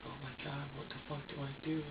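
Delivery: retelling a hushed, desperate thought; quiet despair. Retold or imitated. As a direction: say it under your breath, at a loss. Retold despair at baby's crying.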